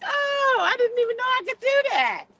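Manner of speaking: hammed-up high-pitched surprise; retold amazement. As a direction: squeal it like a stunning discovery. Retells amazed discovery to viewers.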